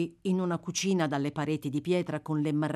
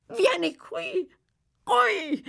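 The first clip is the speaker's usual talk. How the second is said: very high, slow urgent call; 4 dB louder, much slower than usual. Acted. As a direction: beckon the boy frantically. (richiamo acutissimo)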